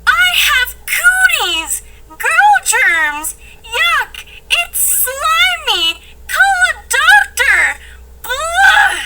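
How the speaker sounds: high, loud grossed-out wailing; disgusted yelling. Acted. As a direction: wail like you caught cooties. Grossed out after the kiss.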